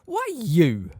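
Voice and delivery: high, loud challenge; aggressive. A narrator's reading. Sirius confronts Snape, aggressively. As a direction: throw the question as an accusation. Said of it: "asked Sirius aggressively".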